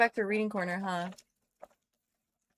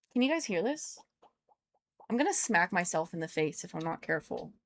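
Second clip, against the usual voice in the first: normal talking voice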